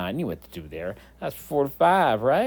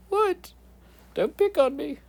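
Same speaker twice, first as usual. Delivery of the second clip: very high, squeaky mock protest; hammed-up high-pitched objection; picked-on big guy. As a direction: play a bullied character in a tiny high voice. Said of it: "telling the big guy what to do".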